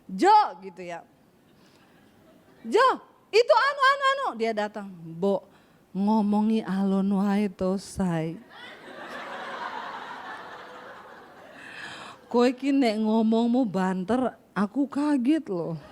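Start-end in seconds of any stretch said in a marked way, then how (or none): 2.6-4.3 high-pitched hurried call to her husband; fast high talk. Retold or imitated. Wife calling her Solo husband, high and hurried. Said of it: "kalau ngomong saudara cepet, nadanya tinggi". 4.9-15.8 low, slow and gentle Javanese husband; drawn-out mild complaint, unhurried; slow soft Solo talk. Retold or imitated. Husband gently asking his wife to speak softer, low and slow. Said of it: "Orang Solo kalau ngomong pelan, halus".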